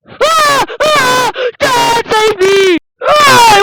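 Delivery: high frantic screams for help; terrified child screaming. Acted. Child being dragged off screams for dad, terrified. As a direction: shriek for dad to save you.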